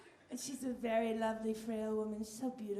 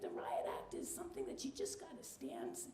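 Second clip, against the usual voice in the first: grandma voice; 10 dB quieter than usual. Retold or imitated. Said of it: "She's like".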